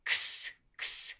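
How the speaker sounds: "ks" breathed, no voice; ks sound demonstrated. Shown as a demonstration. Teacher showing children the "ks" sound, unvoiced. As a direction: hiss out a crisp "ks". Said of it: "can be like a K and S sound".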